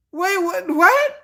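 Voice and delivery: a high, startled "what?"; mock double take. Assistant hearing the request, a startled double take. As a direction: act thrown off. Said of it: "you be like".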